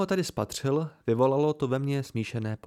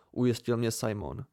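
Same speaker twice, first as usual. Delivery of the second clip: ordinary delivery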